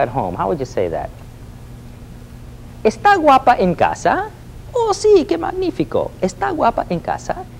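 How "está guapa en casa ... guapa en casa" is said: very high, delighted squeal of a caller; giddy falsetto phone call; excited high voice. Acted. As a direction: sing it up high like a smitten cartoon.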